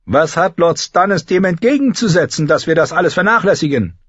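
high-pitched, eager war-council speech; rally the lords, building to a list of enemies; fervent young knight, rousing. Acted. Mark Piper urges Renly's cause.

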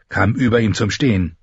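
usual speaking voice

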